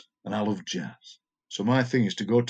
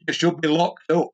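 raised, louder imitation of a shout; shouted heckle; 6 semitones higher, 6 dB louder than usual. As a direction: call it out like a passing heckler. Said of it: "somebody shouted".

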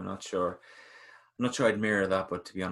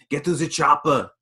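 loud, higher mock Austrian accent; Arnold Schwarzenegger; 6 semitones higher, 10 dB louder than usual. Host retrying the famous line, loud Schwarzenegger accent. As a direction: bellow it in a hammy Austrian accent. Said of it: "sorry for my bad accent".

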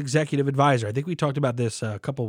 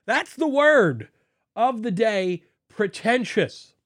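high, slow, posh-ish drawn out word; hammed-up pretentious word of the day; 8 semitones higher, slower than usual. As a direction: say it like a snob. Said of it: "Do I even say that word pretentiously?".

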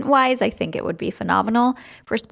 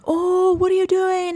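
high, startled mock gasp of a stunned fan; imitating a stunned fan yelling from the seats; shocked fans; 10 semitones higher than usual. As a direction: squeal it like a baffled fan in the stands. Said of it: "They're like".

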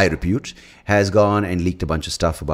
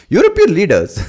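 much higher, louder presenter voice saying "European leaders"; 12 semitones higher, 7 dB louder than usual. Shown as a demonstration. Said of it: "you wanna say it in the presenter voice".

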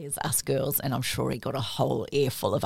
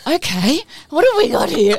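much higher and louder, astonished; amazed aside; 13 semitones higher, 14 dB louder than usual. Retold or imitated. Mum glancing at her husband, astonished. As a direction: react as if you can't believe your ears.

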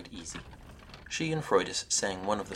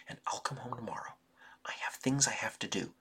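hushed, urgent whisper; 3 semitones higher, 4 dB quieter than usual. A narrator's reading. Sister whispering to her brother so Freydis won't hear. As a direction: keep it secret from the house.

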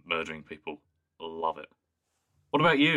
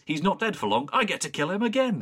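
gleeful villain; 12 semitones higher, 3 dB louder than usual. Retold or imitated. Quoting the Master gleefully.

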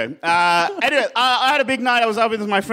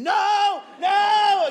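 much higher, panicked shouts of "no"; screaming; 12 semitones higher than usual. Retold or imitated. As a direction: scream "no" like an idiot. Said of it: "I just start screaming".

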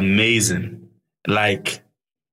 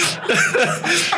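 high teary laugh. Real, not acted. Joyful release after news.

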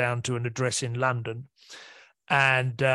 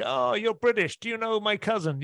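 quoted American; 8 semitones higher than usual. Retold or imitated. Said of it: "they're like".

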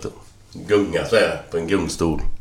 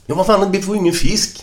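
high-pitched, agitated self-defence; indignant protest; 13 semitones higher than usual. Acted. Fisherman defending himself to his mates, indignantly. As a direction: protest heatedly, voice shooting up.

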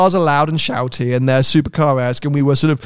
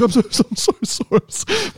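high, stammered apology; mock meek pleading sorry; very apologetic; 7 semitones higher than usual. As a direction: imitate yourself apologising profusely in a high voice. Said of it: "And I was very apologetic".

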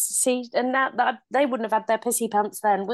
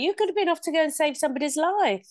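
playful hype; 7 semitones higher than usual. Pretend. Joking superhero fantasy, raised pitch.

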